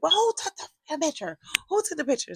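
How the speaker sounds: high, heated retold demand; indignant demand. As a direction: demand it, fed up and outraged. Angry confrontation acted out.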